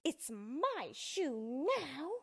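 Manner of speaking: slow, high witchy gloat; spiteful, drawn-out witch voice; witch's hiss. A narrator's reading. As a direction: stretch it out possessively as a witch. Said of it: "hissed the witch".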